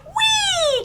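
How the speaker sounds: very high, squealing frog "Wee"; frog's happy cry. Acted. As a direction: let the frog squeal with joy. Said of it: "Frog cried".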